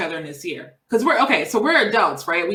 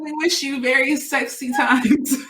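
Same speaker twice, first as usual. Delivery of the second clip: hammed-up exclamation; 6 semitones higher than usual. Playful wish to audience.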